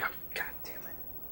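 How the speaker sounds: under the breath curse; exasperated mutter. Retold or imitated. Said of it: "so they're like".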